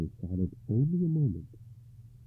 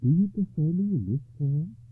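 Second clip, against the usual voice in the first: teasing lady; 9 semitones higher, 6 dB louder than usual. Acted. Ragnall teasing.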